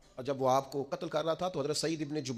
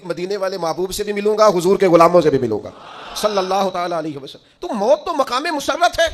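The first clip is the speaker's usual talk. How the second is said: fervent preaching shout; 11 dB louder than usual. Acted. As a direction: build the joy of meeting the Beloved into a shout. Preacher rising to climax.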